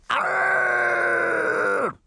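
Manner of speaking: high shouted beast roar; monster roar. Acted. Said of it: "roared so loudly".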